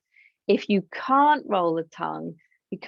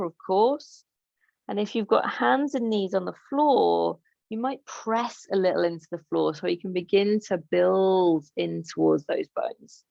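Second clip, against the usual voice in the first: usual speaking voice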